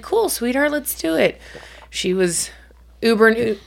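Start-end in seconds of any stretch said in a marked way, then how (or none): 0.0-1.4 higher, sugary cheerful mom; encouraging mom reply, a bit higher; sweet mom voice. Retold or imitated. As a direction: answer your kid brightly and higher. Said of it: "I was like".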